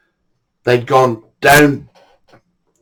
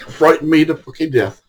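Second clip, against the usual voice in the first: normal talking voice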